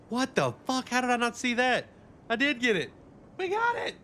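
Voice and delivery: yelling in joyful shock; excited shouting. Real, not acted. Loot finally dropped, he shouts.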